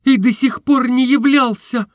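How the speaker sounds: high, strained cry; gathering strength. A narrator's reading. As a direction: force it out, high and tense. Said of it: "отвечала Настенька, как будто собираясь силами". (высокий надрывный возглас)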